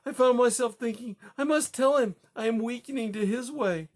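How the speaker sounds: high, strained grieving account; distraught. Acted. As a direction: relive it, barely holding together. Widow recounting husband's death.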